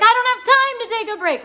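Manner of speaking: high, loud, hammed-up panic; frantic urgency. Client refusing intuition's advice to rest, frantic high protest. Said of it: "that urgency".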